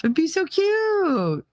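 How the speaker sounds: high, drawn-out coo; gushing. Real, not acted. Picturing a pal dancing as an elf, gushing.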